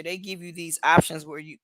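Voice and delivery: ordinary delivery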